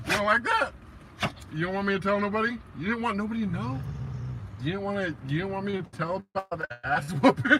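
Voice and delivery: high, mock-sympathetic pet voice; high-pitched doggy questions; teasing puppy talk. Real, not acted.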